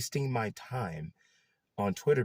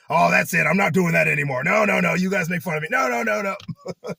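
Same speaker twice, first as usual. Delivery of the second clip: imitating the boss, higher and louder in playful huffy refusal; mock protest; 7 semitones higher, 7 dB louder than usual. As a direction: play the boss pretending to be offended. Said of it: "and he's like".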